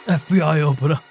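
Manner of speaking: loud shout of a raid. Acted. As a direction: bark it like a cop. Shouted at the door.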